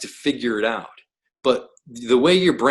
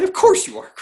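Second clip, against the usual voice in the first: much higher, playful jab; sing-song higher teasing repeat; mock-knowing tease; 4 dB louder than usual. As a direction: tease him like it's obvious. Teasing guest about Bruce Lee.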